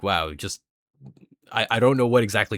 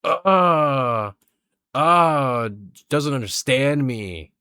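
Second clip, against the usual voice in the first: louder, crying, broken sobs; sobbing; 2 semitones higher, 4 dB louder, much slower than usual. Acted. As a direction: break down into tears. Acted crying teen.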